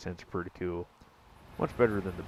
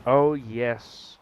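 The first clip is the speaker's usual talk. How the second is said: usual speaking voice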